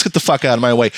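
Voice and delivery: ordinary talk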